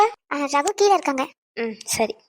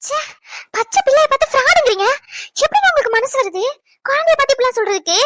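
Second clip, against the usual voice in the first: shocked scolding; 8 semitones higher than usual. Acted. Wife scolds husband over baby.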